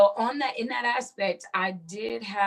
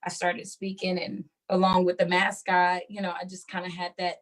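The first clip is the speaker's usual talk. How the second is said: usual speaking voice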